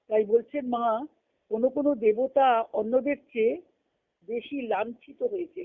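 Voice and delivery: ordinary talk, nothing marked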